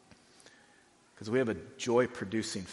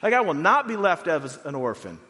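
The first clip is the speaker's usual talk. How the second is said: much higher and louder, ringing certainty; triumphant Paul; 7 semitones higher, 6 dB louder than usual. Retold or imitated. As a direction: proclaim it like victory is already won. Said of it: "Paul knows, like".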